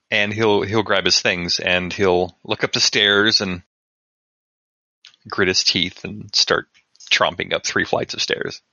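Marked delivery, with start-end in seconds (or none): none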